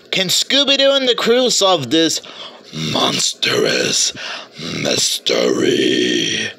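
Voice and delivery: deep slow monster voice; scary voice. Pretend. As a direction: drop into a spooky growl. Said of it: "I'll stop using that scary voice".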